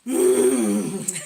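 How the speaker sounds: deep growling hum; low roar. Real, not acted. Odd roar sound.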